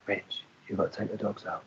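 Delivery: a small voice in his head nudging him; little inner voice. Retold or imitated. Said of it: "this little voice goes".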